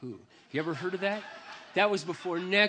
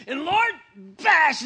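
slow, loud furious roar; angry outburst; 12 semitones higher, 10 dB louder, much slower than usual. Retold or imitated. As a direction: let the rage out with the blow. Smashing stick, venting to God.

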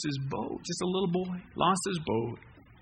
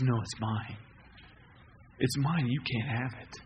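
a shopkeeper not budging; stern store owner; 5 semitones lower, 5 dB quieter than usual. Acted. Store owner to boy, low and stern refusal. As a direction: lower and softer, flat refusal.

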